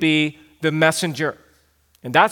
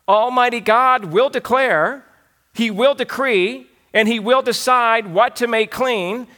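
preaching with rising force; 4 semitones higher than usual. Acted. Preacher building to climax.

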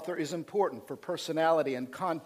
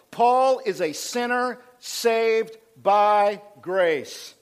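loud and very slow, phrase by phrase; slow, emphatic proclamation; 4 semitones higher, 6 dB louder, much slower than usual. Real, not acted. Preacher telling the church who Paul is, slow emphatic proclamation. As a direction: spell out "sinner saved by grace" with weight on each word.

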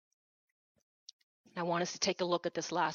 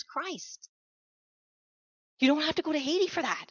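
sudden high, ringing preacher cry; preaching at full force; 8 semitones higher, 4 dB louder, slower than usual. Real, not acted. Preacher crying out praise to the church, full force. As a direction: lift the praise up to the congregation.

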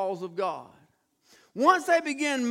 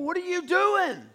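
high, louder mock-indignant demand; exasperated outburst; 8 semitones higher, 3 dB louder than usual. King confronting the prophet he paid, exasperated outburst. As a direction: burst out in acted exasperation.

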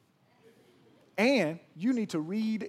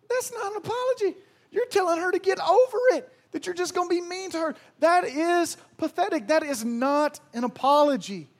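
much higher and louder indignant rebuke; exasperated outcry; 13 semitones higher, 9 dB louder than usual. Acted. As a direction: blast the excuse as pathetic.

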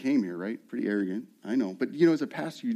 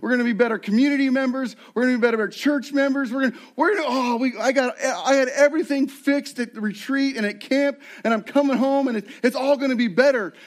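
gushing post-retreat zeal; 8 semitones higher than usual. Retold or imitated. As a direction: gush like someone fresh home from a retreat. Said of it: "we're like".

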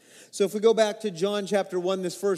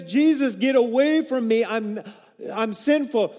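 pleading outcry; 5 semitones higher, 6 dB louder than usual. Acted. Peter confessing his sin to Jesus.

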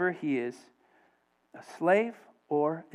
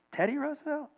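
much higher, incredulous query; puzzled question; 10 semitones higher, 4 dB quieter, faster than usual. Retold or imitated. Missionary asking a man about the sign, puzzled. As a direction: lift it high in mock disbelief. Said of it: "he looks over to a guy and he says".

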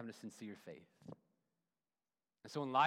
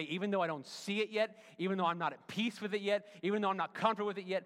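higher and louder, pleading harder each line; pleading prayer; 7 semitones higher, 8 dB louder than usual. Pretend. Modelling a prayer of trust for the church, loud pleading. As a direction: build the plea line by line. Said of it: "To say".

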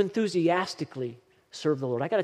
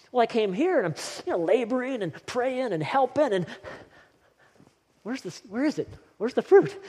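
exasperated; 7 semitones higher than usual. Retold or imitated. Pastor voicing his own frustration.

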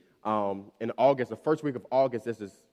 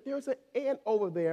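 put-on upset little kid; 11 semitones higher than usual. Quotes his crying small daughter.

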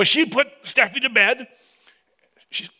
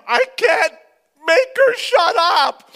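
high, louder, half laughing half crying; desperate plea; 10 semitones higher, 5 dB louder, slower than usual. Retold or imitated. Father on phone begging wife, high desperate half-sob. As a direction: wail it slowly, at wit's end.

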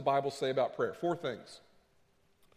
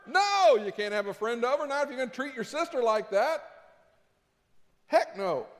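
stern parent; 8 semitones higher, 4 dB louder than usual. Acted. Parent answers kid.

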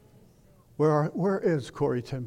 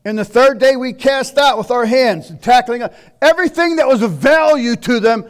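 loud preaching read, pitched higher; scripture read aloud; 7 semitones higher, 10 dB louder than usual. Acted. Preacher booms scripture to his congregation, loud and raised. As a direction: thunder out the verses to the congregation.

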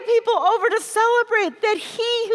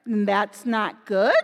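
"that's not good" said low and slow; deadpan, ominous understatement; low, slow dread; 9 semitones lower, 3 dB quieter, slower than usual. Acted. Reaction to bad news.

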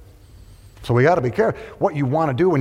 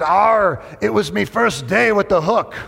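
pirate voice; 5 semitones higher than usual. Acted. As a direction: punch the pirate's punchline. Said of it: "He said".

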